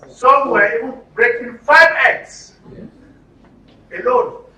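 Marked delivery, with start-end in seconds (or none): none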